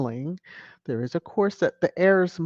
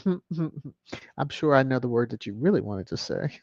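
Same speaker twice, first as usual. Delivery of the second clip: ordinary delivery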